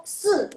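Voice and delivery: se hit high and falling; fourth-tone demo. Shown as a demonstration. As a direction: snap se downward from the top. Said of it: "nada keempat".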